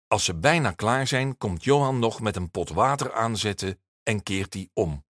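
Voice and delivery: ordinary delivery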